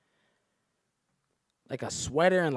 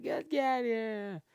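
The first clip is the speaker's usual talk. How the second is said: put-on girl's voice; 11 semitones higher, slower than usual. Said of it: "She's like".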